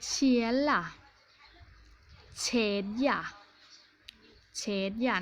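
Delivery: เชียล่ะ and เชดย่ะ begun with a soft hushing ch; soft ch sound. Shown as a demonstration. Teacher giving Swedish examples, เชียล่ะ and เชดย่ะ with soft ch. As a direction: model เชียล่ะ, เชดย่ะ as separate words with soft ch. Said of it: "จะออกเป็นชอช้าง".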